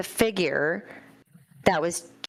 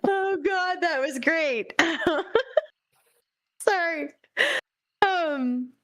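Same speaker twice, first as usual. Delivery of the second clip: laughing apology; 10 semitones higher than usual. Real, not acted. Player still laughing.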